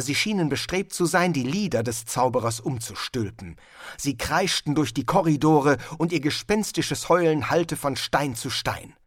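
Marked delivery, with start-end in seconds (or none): none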